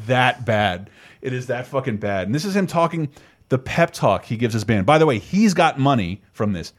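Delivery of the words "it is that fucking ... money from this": ordinary delivery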